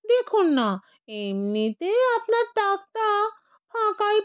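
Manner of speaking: high, impish ghost voice wheedling; a sly little ghost selling his idea; cheeky ghost. Acted. The ghost coaxes the old man, a high, cheeky voice.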